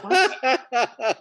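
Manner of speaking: high, laughing, incredulous what; mock baffled what. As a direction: play it baffled and amused. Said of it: "people were like".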